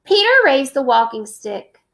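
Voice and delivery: ordinary talk, nothing marked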